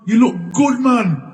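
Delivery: high, loud happy call; excited greeting. Retold or imitated. Greeting his dead friend in a dream, high and delighted. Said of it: "I look at him and said".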